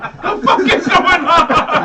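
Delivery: high, loud, drawn-out mock bewilderment; retold shocked outcry. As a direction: yell it slowly, cracking up. Contractor's shocked reaction, retold.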